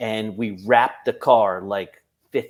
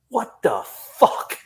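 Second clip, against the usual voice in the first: shocked; 9 semitones higher, 3 dB louder, slower than usual. Retold or imitated. Angry victim of prank.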